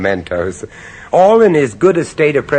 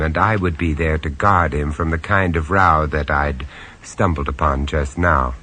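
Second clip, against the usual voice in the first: low-key, wry first-person narration; lightly amused storyteller; story narration; 4 semitones lower than usual. A narrator's reading. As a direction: confide it to the listener.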